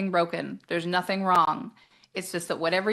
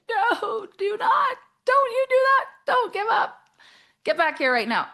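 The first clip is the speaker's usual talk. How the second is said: much higher and louder, half-laughing plea; pleading yell; 6 dB louder, slower than usual. Retold or imitated. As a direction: beg them not to give up, almost shrieking. Urging people not to quit.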